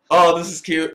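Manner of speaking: normal talking voice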